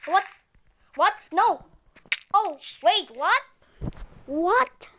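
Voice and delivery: startled shouts at the screen; shocked yelling. Real, not acted. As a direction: yell in sudden disbelief. Panicked shock at game event.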